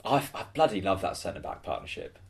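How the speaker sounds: usual speaking voice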